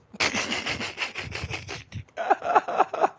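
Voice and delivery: high breathless laugh; panicked laugh. Real, not acted. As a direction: laugh out in shock. Laughs after ambush.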